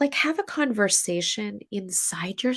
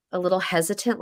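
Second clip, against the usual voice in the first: normal talking voice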